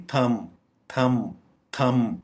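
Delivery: "थंब" as thum, closing on m; silent b. Shown as a demonstration. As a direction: model "थंब" without the b. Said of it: "बी silent करेंगे".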